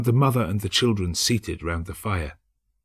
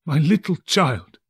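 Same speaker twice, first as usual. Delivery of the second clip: breaking down; 7 semitones higher, 3 dB louder, slower than usual. A narrator's reading. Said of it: "He broke down all at once".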